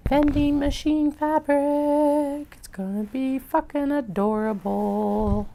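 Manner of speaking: high, slow gushing delight. Real, not acted. Showing off vending machine fabric, high, slow gush. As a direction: coo over the cute fabric.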